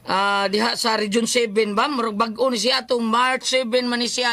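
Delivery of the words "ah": ordinary delivery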